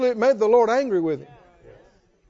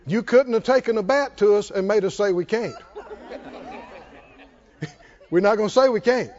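normal talking voice

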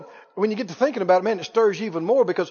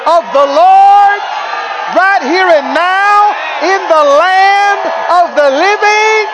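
loud, high preacher shout; preaching at full force; 7 semitones higher, 5 dB louder than usual. Acted. Preacher rousing the congregation, full-force shouting. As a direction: drive it home to the church.

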